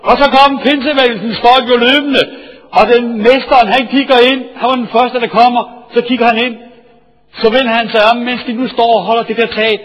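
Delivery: loud, high, driving sermon storytelling; full-force preaching. Acted. Preacher thrills his congregation with the roof story, loud and high.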